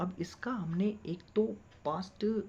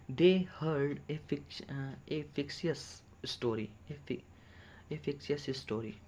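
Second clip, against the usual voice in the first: slow dictation; much slower than usual. Shown as a demonstration. Teacher dictates slowly.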